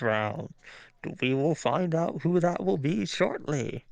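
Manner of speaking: put-on lightly old-timey radio announcer; 1950s announcer. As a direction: announce the match like a fifties ringside host. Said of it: "Can you do a nineteen fifties announcer?".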